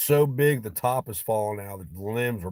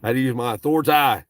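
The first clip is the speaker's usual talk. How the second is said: much louder mock authority boast; louder hammed-up authority; 4 semitones higher, 10 dB louder, faster than usual. Real, not acted. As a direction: boom it out as the boss.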